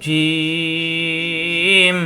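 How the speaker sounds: জিম with a very long sustained "ee", closing on m; chant-like, greatly drawn-out জিম; four-alif stretch. Shown as a demonstration. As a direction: hold the vowel of জিম on one steady note. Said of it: "উপরে তালুতে চাপ দিয়ে".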